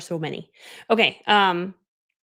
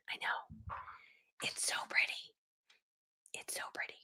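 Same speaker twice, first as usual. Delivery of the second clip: whispered, doting aside; hushed admiring aside; 18 dB quieter than usual. Real, not acted. Showing off her pretty gear, whispered admiration. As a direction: murmur it almost without voice, to yourself.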